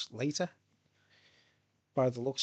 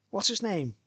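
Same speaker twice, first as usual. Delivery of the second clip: normal talking voice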